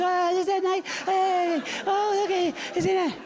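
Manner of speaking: lightly voiced swooning royal watcher; mock gushing fan. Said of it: "we watch their weddings like dickheads".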